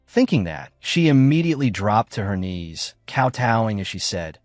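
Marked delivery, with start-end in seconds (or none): none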